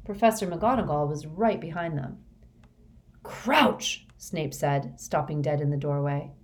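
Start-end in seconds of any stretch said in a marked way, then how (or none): 3.2-4.1 higher-pitched startled cry; shocked exclamation. A narrator's reading. Snape stops dead, seeing Crouch.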